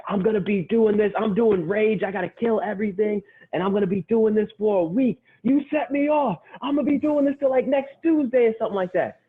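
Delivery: high-pitched, hammed-up rampage rant; imitating a villain flipping out. Retold villain flips out, rampaging.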